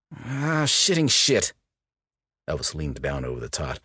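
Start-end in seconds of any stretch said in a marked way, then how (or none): 0.1-1.5 loud high exasperated swearing; frustrated curse. A narrator's reading. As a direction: blurt it out in frustration.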